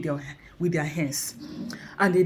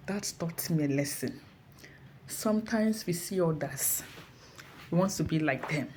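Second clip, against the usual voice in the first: ordinary delivery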